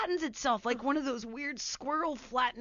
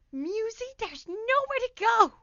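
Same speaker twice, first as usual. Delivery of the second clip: much higher, excited imitation of mom; high-pitched frantic mom; 13 semitones higher, 8 dB louder than usual. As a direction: squeal it as mom cornering the cat, getting louder. Acts mother cornering the cat.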